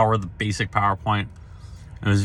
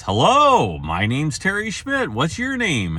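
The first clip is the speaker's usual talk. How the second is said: higher, lightly voiced cheerful professor; a hint of a hearty doctor saying hello; Doctor Schmidt greeting; 7 semitones higher, slower than usual. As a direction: greet him brightly with a raised pitch. Greeting voiced in a retold meeting.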